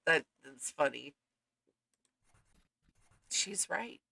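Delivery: normal talking voice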